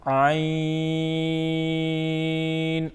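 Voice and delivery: عین held very long; drawn-out letter name. Shown as a demonstration. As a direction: stretch عین slowly. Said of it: "حروف پڑھتے ہیں عین اور حمزہ کو". (عین کو بہت لمبا کھینچیں)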